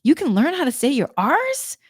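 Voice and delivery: lilting, high-pitched wonder at a discovery; curious, fascinated. Retold or imitated. Seventeen-year-old girl marvelling at learning R's, curious and fascinated. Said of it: "was curious about that".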